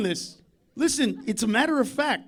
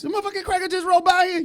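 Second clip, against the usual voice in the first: fast, higher questioning neighbour; 8 semitones higher, faster than usual. Pretend. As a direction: rattle it off like a curious neighbour. Another neighbour asks.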